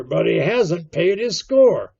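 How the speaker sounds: loud, high shouted order; urgent bellowed command; crying out. A narrator's reading. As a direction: send a man running with a yell. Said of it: "cried Silver".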